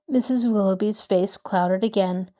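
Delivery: ordinary delivery, nothing marked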